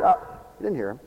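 usual speaking voice

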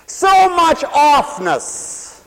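drawn-out and loud; emphatic. Real, not acted. Preaching to the congregation, slow emphatic stress. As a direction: stretch every word out loud.

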